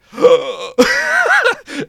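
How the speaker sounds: sharp high gasp of fright; shocked gasp. Retold or imitated. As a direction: gasp like a terrified player. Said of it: "And you're like".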